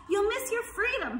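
very high goose voice; honking goose. A narrator's reading. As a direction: honk a warning. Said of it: "honked the goose".

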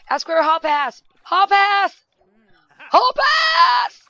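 building from loud to a high, crying shout; yelling at the screen. Real, not acted. Yelling at Jesse on screen to get a hall pass, rising to a shout. Yelling advice at game character.

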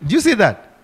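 normal talking voice

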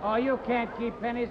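normal talking voice

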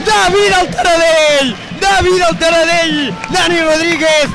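excited hailing. Real, not acted. Hailing the scorer as god.

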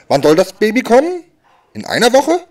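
high, rising astonished questions; incredulous surprise. Acted. Comedian reacting to a pregnant woman's due date, astonished.

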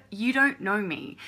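loud, furious rant at the trolls; angry at trolls. Real, not acted. To the trolls watching the channel, a loud angry rant. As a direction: yell it at the trolls, fed up.

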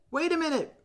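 put-on strained, high boyish shout; boyish call. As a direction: call out as the stuck boy in the bushes. Piggy calls out, stuck.

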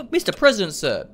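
gasp, then high, loud salute; hammed-up gasping greeting; mock awe. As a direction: exclaim it like a starstruck underling. Mock greeting to a president's portrait.

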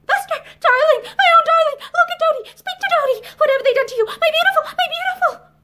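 very high, loud frantic wail; hysterical, grief-stricken keening; anguished cry. A narrator's reading. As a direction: cry out to a lifeless child. Mother over her lifeless child.

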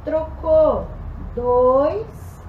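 loud, called-out counts; shouted coach count. Real, not acted. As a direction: call the count across the gym. Coach calling exercise counts.

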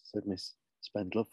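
plain reading voice

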